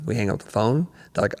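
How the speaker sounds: normal talking voice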